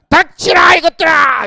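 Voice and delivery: high, slow bellowed command; retold shout. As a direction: roar it at the dozing class. Teacher yelling at sleepy class. (졸린 학생들에게 원장이 고함치며 조용히 하라고)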